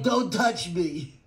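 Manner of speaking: slow, drawn-out put-on voice for Billy; Billy's slow, put-on don't-touch-me voice; mimicking Billy. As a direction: act out Billy pulling away, slowly. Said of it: "Billy's like".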